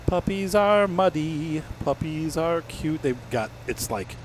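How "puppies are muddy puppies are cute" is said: high, slow doggy talk. Real, not acted. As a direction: coo at the puppies. Talking to the puppies.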